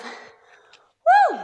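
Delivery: high whooping cheer; real triumphant whoop. Real, not acted. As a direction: let out a joyful woo after the set. Instructor cheers after push-ups.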